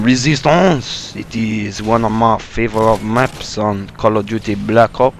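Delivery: mock French accent, drawn out slowly; bad French accent. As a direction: put on a hammy, slow French accent. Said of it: "doing the French accent".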